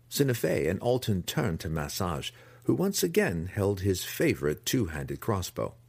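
ordinary talk, nothing marked

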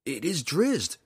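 high, urgent protest; crying out. A narrator's reading. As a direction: cry out to identify yourself. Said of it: "the drow cried".

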